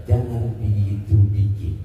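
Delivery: calm, non-Batak reassuring version; changed tone. Shown as a demonstration. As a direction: soften jangan gitu bikin, low and unhurried. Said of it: "Suaranya saya roba, gayanya saya roba".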